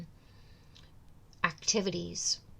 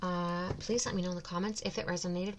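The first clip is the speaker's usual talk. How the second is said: normal talking voice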